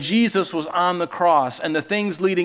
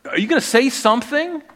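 much higher, louder, taunting voice; Pilate's high, loud threat; mocking Pilate; 8 semitones higher, 5 dB louder than usual. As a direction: sneer down at the prisoner. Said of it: "And he's like".

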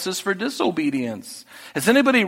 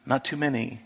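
normal talking voice

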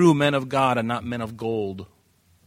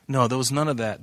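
normal talking voice

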